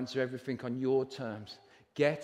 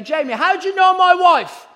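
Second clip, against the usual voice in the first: mock jealous challenge; 16 dB louder than usual. Mock jealous question to Jamie.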